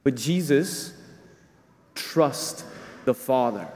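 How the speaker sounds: normal talking voice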